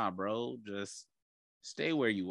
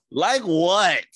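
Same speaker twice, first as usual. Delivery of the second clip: high, loud, disbelieving like what; incredulous; 9 semitones higher, 10 dB louder than usual. Real, not acted. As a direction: ask it in loud mock disbelief. Mocking question, laughing banter.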